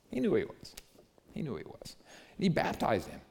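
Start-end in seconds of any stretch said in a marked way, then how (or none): 0.1-0.7 emphatic high. Acted. Repeats the line twice. 1.3-2.0 quiet echo. Acted. Repeats the line twice.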